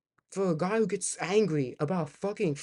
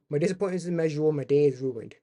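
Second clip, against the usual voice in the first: rattled-off meme line; 3 semitones lower, faster than usual. Retold or imitated. Meme quote rattled off fast.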